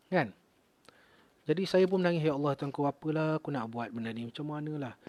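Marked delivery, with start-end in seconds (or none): 2.3-4.9 hushed, pleading prayer; soft prayer. Retold or imitated. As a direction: murmur it to God in despair. Retold desperate prayer.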